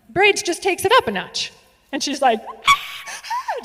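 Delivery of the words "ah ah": very high panicky gasping ah; imitated hyperventilating shriek; mock hyper scream. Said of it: "she's hyper screaming and hyperventilating".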